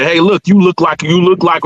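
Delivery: ordinary talk, nothing marked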